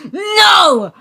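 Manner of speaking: high, loud cry of refusal; shouted no; defiant shout. Acted. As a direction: yell it out, refusing. Character bursts out in defiance.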